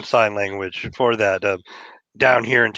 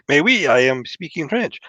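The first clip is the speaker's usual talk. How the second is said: higher, hammed-up French accent; thick French accent; 5 semitones higher than usual. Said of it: "I said it with a thick accent".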